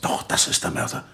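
insistent whisper; whispered accusation. Acted. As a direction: press the point, still under your breath. Insists in hushed voice.

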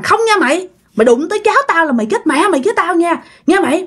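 high-pitched defiant threat; acted feisty, angry refusal; fierce grandmother. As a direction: warn off the ghost to protect a grandchild. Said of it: "bà nội làm như là hung dữ lên".

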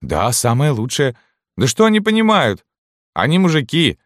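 very high, loud, scornful woman's voice; scoffing canteen woman. A narrator's reading. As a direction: dismiss them in a high-pitched huff. (маркитантка, высоко и пренебрежительно)

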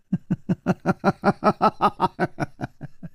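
soft mocking scoff of a laugh; disbelieving sneer at the name; cold laugh. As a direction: laugh him off with contempt. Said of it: "这个女子就冷笑起来".

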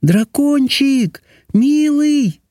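very high, drawn-out princess coo; affectionate singsong in a high princess voice; tender calling. A narrator's reading. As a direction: call to the beast sweetly, stretching each word. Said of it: "ласково позвала".

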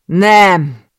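loud, high cry of denial; shouted denial. Acted. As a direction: scream no in disbelief. Shocked denial of news. (hangos, magas tagadó kiáltás)

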